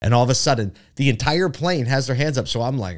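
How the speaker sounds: normal talking voice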